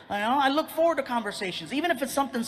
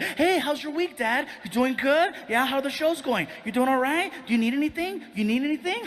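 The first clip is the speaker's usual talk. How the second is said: put-on higher, eager kid with an agenda; very chatty; 5 semitones higher than usual. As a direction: pepper Dad with friendly, higher-pitched questions. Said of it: "he becomes very chatty".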